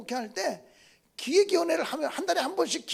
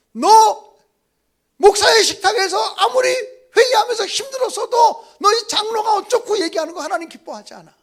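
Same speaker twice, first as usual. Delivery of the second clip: loud, higher divine scolding; booming rebuke; 7 semitones higher, 13 dB louder than usual. Retold or imitated. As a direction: thunder it down like God scolding. God's voice rebuking the pastor.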